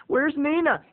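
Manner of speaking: high-pitched acted anxious question; nervous. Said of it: "I was nervous".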